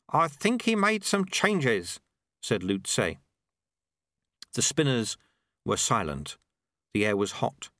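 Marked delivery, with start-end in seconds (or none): 0.1-2.0 high, slow considered reply; sweeper's character voice. Acted. Sweeper answering Unity after the blast, high and slow. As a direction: answer slowly in a raised character voice.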